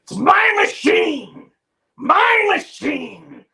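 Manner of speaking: high, slow, crying shout of my machine; doctor yelling at the interns, slow furious shout. Retold or imitated. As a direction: wail it like a possessive tantrum. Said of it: "and he gets so mad".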